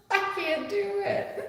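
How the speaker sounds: high giggling words; real laughter breaking up the words; laughing. Real, not acted. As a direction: laugh through it, giving up. Laughing at failed try.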